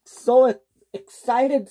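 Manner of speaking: high, slow and stuttering with excitement; excited stammer. Acted. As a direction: trip over the words in giddy excitement.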